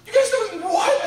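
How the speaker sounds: high, loud, laughing disbelief; incredulous shout. Retold or imitated. Moshe shouting at the people in disbelief. Moshe shocked at golden calf.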